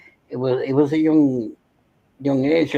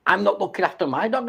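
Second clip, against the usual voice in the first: ordinary talk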